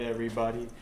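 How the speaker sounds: ordinary talk, nothing marked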